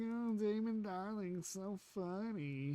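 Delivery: high, slow, drawn-out fawning voice; mocking gushing fans. Streamer mimics the prankster's adoring fans, high mock gushing. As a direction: parody a fan swooning over a comedian. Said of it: "And they're like".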